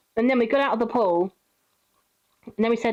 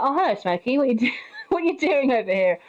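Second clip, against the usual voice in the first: raised, lightly voiced hello of mock surprise; light retold surprise; mock surprised greeting; 4 semitones higher than usual. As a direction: greet her like a surprise visitor.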